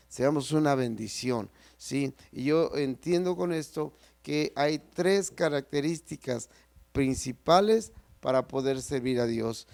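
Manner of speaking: ordinary talk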